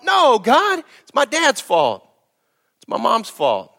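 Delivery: high, whiny blame-shifting; mock excuse. Mock sinner blaming God.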